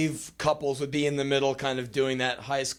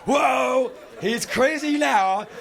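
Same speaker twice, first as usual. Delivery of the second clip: high, loud whooping announcement; mock-wild bragging; 12 semitones higher, 6 dB louder, slower than usual. Boy arriving at the dance after one beer, mock-wild.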